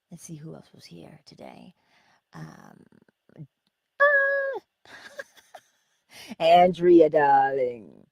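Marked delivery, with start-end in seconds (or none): 4.0-4.6 sudden loud, high "ah!"; high excited squeal. Real, not acted. Host spotting a viewer in chat, excited squeal. 6.4-8.1 loud, effusive hello, falling at the end; loud greeting. Real, not acted. Host greeting a viewer in chat, loud effusive call.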